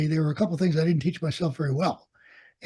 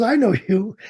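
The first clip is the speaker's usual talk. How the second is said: amused, recognizing him with a laugh; 6 semitones higher than usual. Retold or imitated. Company commander greeting old student with a laugh. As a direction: chuckle it out warmly.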